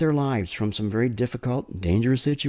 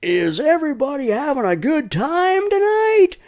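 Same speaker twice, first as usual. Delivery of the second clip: high, loud concert emcee pumping the crowd; cheesy stage host hyping a concert crowd; hype MC; 13 semitones higher, 4 dB louder, slower than usual. Retold or imitated. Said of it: "So they'll say something like".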